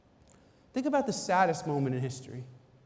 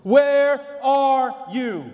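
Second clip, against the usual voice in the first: slow, loud, higher call; drawn-out pleading call; 5 semitones higher, 8 dB louder, much slower than usual. Acted. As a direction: stretch each word, calling into the garden. God calling out again.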